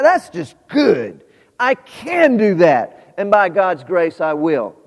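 big, loud, slow preaching declaration; confident proclamation. Acted. Preacher answering the doubting voice with booming resolve. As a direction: preach it out with full force.

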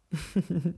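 soft, brief closed chuckle; real little laugh at oneself; small chuckle. Real, not acted. Teacher laughs at her hard demo, soft chuckle. Amused at her own difficulty.

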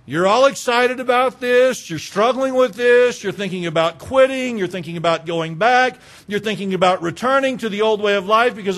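loud, high exhortation from the pulpit; urgent pulpit preaching; full-force preaching. Acted. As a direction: drive it home like a revival preacher.

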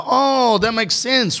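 high, drawn-out mock "oh"; exaggerated high-pitched realisation; pointed realisation. Acted realisation aimed at Whoopi.

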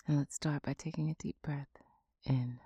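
soft unvoiced breathing guidance; guided meditation whisper. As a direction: whisper them calmly through each breath.

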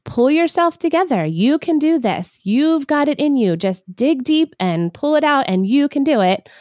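rally her like a hype coach; pushy pep talk. Retold or imitated. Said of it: "just saying".

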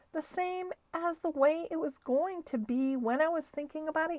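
a small hurt voice sulking over his canoe; upset little Stuart. A narrator's reading. Mouse Stuart speaks, upset.

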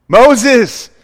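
loud double call of Moses' name; a booming divine call; God calling out. Retold or imitated. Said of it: "There's this double emphasis".

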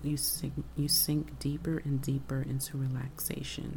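usual speaking voice

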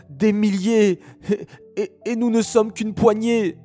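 scared fighters stammering in fear; frightened soldiers. Acted. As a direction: panic at the size of the enemy. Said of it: "ils commencèrent à trembler".

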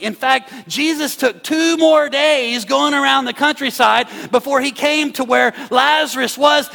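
high, loud, driving preacher cadence; preaching at full force. Acted. Pastor retelling Lazarus to his church, preaching at full force. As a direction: build the story to the congregation, full force.

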